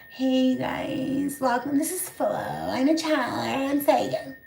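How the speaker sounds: soft, drawn-out airy greeting; fake channeler persona. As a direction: put on a phony serene channeler voice. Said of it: "You didn't sound like that".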